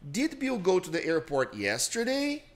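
slow, tune climbing to the end; rising question intonation. Shown as a demonstration. Teacher demonstrating a rising question tune. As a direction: let the question melody rise steadily. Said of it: "ele vai crescendo".

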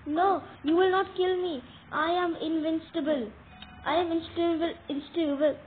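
loud frightened bravado; defiant loud cry. Real, not acted. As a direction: shout it at the monster, panicking. Defying attacking monster.

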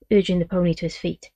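ordinary delivery

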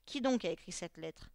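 ordinary delivery

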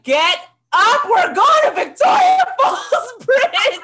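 loud, high, laughing wake-up call; hammed-up excited shouting at a sleepy cousin. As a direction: yell it like you can't wait to go. Waking cousin for bungee trip.

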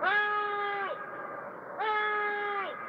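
high, desperate cries; calling for help. Real, not acted. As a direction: cry out for help from afar. Calling from the car.

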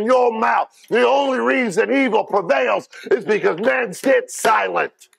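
a preacher daring his flock to ride, shouting; preaching at full force. Acted. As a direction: build to a roaring challenge to the congregation.

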